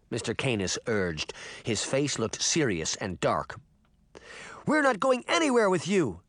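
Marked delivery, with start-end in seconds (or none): 4.7-6.2 defiant cry. A narrator's reading. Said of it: "Sabrina cried".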